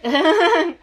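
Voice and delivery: loud, high laugh; short real cackle breaking out; burst of laughter. Real, not acted. Laughs after plugging the show.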